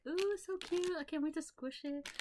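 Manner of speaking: high-pitched gushing over something cute; ooh-ing in a high voice; excited cooing. Retold or imitated. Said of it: "I was just like".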